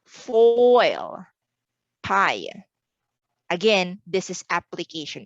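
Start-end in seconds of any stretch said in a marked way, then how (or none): 0.0-1.2 "Foil" stretched and louder, gliding through the vowels; drawn-out diphthong. Shown as a demonstration. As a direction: lean long on the oi in "Foil". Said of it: "a battle between A and O sound".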